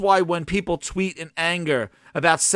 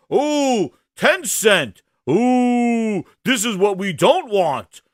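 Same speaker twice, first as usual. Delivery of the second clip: mock alarm; 2 semitones higher, much slower than usual. Mocks community alarm about Tencent.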